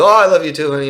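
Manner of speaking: high, loud, gushing "Oh, I love you too"; relieved reply. Retold or imitated. Partner answering the first to open up, high and gushing. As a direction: gush it back with sudden relief.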